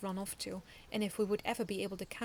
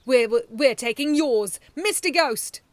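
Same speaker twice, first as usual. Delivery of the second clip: loud, slow defiant call; loud villain command; 4 semitones higher, 15 dB louder, slower than usual. A narrator's reading. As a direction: summon the ghost. Villain summoning ghost.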